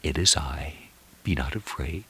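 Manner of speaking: whispered, very soft and tender; reassuring whisper. Acted. The Lord reassures a troubled believer, whispered. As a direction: hush a frightened heart. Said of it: "and reassuringly".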